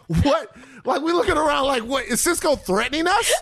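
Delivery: high, laughing disbelief; can't believe he threatened us; incredulous laughing. Real, not acted.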